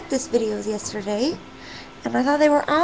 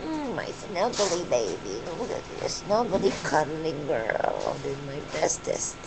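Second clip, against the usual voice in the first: snuggle into the words, low and gentle; tender cooing; 10 semitones lower, 6 dB quieter than usual. Real, not acted. Owner cuddling her snuggly bird, tender low cooing.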